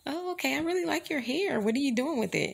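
high, sweet put-on coworker voice; mock coworker. Straight-haired coworker asking about her hair, high sweet mock voice.

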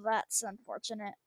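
usual speaking voice

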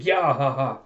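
spelled-out mock guffaw; imitated thug laugh. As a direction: act out a jeering gang's laugh. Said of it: "thugs just laughing with a".

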